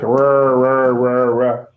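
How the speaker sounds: low, repeated rah with a heavy R; exaggerated American R on each rah; rhotic R sound. Shown as a demonstration. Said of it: "Rhotic means like".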